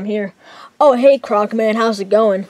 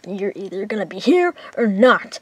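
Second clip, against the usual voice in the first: ordinary delivery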